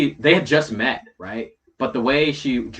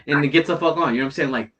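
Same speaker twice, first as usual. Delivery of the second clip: usual speaking voice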